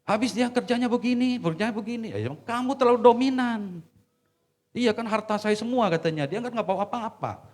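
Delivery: high, loud back-and-forth; heated retelling. Retold or imitated. Pastor reenacting his argument with the wife, loud. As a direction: argue both sides with heat.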